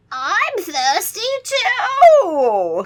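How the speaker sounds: booming vampire count. Acted. Count Nightwing announcing himself, slow and loud. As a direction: stretch it out like a villain's entrance.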